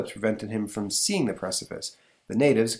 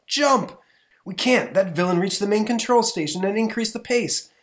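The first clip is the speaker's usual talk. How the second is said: higher, louder panicked cry; high urgent alarm; alarmed hero; 11 semitones higher, 6 dB louder than usual. Acted. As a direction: shout the warning like a comic hero. Characters cry out in danger.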